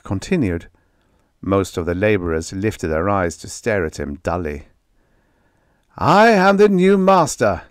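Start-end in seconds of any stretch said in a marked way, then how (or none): 6.0-7.6 high, loud yell over machine noise. A narrator's reading. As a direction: shout it above the din of the factory. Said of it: "he yelled above the din".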